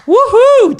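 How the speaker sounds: very high, loud whoop; gleeful whoop. Real, not acted. Comedian delighted by his own dig, high gleeful whoop. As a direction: let out a real high cheer.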